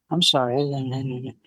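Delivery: low, very slow mock apology; cowed husband. Husband to scolding wife, meekly cowed. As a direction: shrink into a meek apology. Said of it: "he just all of a sudden became".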